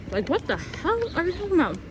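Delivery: high, exasperated repeat; outraged disbelief. Retold or imitated. Vlogger retelling her reaction to the ex, high outrage. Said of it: "I was like".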